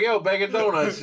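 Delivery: high, loud mock call-out; street hail. Calling a buddy by his nickname, high loud mock hail. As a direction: shout it like a street greeting. Acting out the nickname being called.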